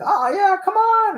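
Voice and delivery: very high-pitched egging on; imitated crowd cheering. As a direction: mimic fans urging him on in a high voice.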